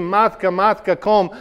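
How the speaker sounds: loud, high call for his mother; frightened boy shouting for his foster mother; retold scream. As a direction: cry out for Matka, a boy's panic. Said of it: "And I screamed".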